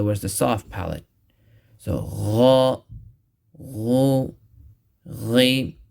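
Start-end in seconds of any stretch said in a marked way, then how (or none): 1.8-5.8 gargle it at the back of the tongue; full-mouth ghain. Shown as a demonstration. Teacher drilling ghain for students. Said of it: "pronounce it with the full mouth".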